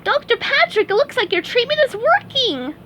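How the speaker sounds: very high squeaky cartoon sponge; chirpy high-pitched sponge, cheerful; SpongeBob voice. SpongeBob praising Doctor Patrick, high squeaky sponge voice.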